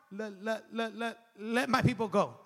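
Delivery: a heavy mock stutter on let; stuttering Moses. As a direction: stammer over let, let, let, then push out the rest. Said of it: "Remember, he stuttered".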